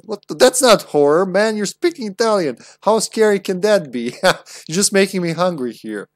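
normal talking voice

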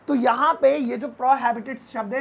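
ordinary talk, nothing marked

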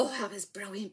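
ordinary talk, nothing marked

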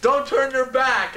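drawn-out high yell, slow and loud. Retold or imitated. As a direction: shout it slowly across the crowd. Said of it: "and he yells".

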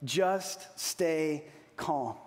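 each word drawn out, very slow; slow emphatic repeat. Shown as a demonstration. Preacher repeating the key phrase, very slow and calm. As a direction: savour each word slowly. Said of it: "I love that sentence".

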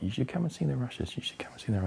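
quietly urging, dropping to a whisper; hushed coaxing. Retold or imitated. Director coaxing actor to watch rushes, hushed. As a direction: coax him in a hushed voice. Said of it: "Ray's like".

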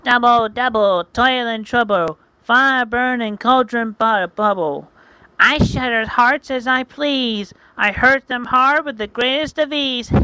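high chanting witch over her cauldron. Acted. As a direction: chant the spell like a gloating witch.

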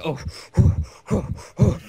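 loud, puffed-out mock panting; retold hyperventilating, hammed up; heavy breathing. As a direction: imitate someone huffing heavily. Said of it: "she's, like, breathing heavy".

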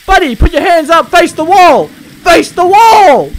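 loud, high, drawn-out order; furious police shout. Pretend. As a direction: roar it at the suspect. Cop shouting commands at suspect.

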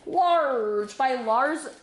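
milk the word LARGE for comic effect; playfully drawn-out. Pretend. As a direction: stretch LARGE out very long and slow. Playfully repeats the size word.

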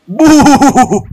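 high, loud wavering boo; imitated ghost boo. Player imitating a ghost popping out, high loud booing. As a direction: hoot it out like a cartoon ghost.

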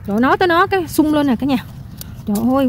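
ordinary talk

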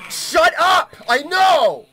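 high, very loud yell; exasperated shout. Real, not acted. Player telling the gremlin to shut up, furious shout. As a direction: shout it down in frustration. Yelling at noisy game creature.

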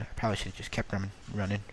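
ordinary delivery

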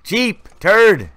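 high, loud repeated shouts; re-enacted urgent call-out. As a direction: holler to get a teammate's attention. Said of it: "I was calling the Turd right here".

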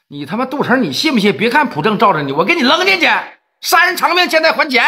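high-pitched threatening shout at Du Cheng; angry threat. Acted. Gangster threatening Du Cheng over the dead, high angry shout. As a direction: bark the threat, pitch climbing.

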